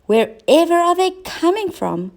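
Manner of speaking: high, wondering cat voice; puzzled little cat asking; curious cat voice. A narrator's reading. Cat voiced in read story.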